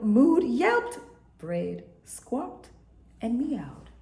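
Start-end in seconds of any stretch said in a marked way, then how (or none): none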